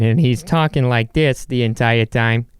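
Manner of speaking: a rough stab at Peter Griffin's voice; half-hearted cartoon dad imitation; lightly voiced cartoon dad droning on; Peter Griffin impression. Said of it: "it's Peter Griffin".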